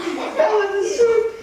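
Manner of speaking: very high theatrical wail; hammed-up tearful rooster; mock crying rooster. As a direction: wail out the bad news. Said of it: "the crying rooster".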